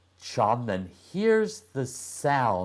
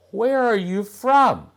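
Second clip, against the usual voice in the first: put-on boy's voice; 13 semitones higher, 8 dB louder than usual. Boy asks the girl.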